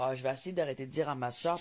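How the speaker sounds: normal talking voice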